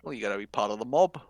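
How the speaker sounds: usual speaking voice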